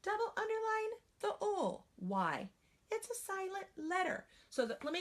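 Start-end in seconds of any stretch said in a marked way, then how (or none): 0.0-1.8 put-on high childlike voice; teacher playing the trained student who knows the marking. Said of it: "The student would say". 2.9-4.2 put-on high childlike voice; teacher playing the trained student who knows the marking. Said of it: "The student would say".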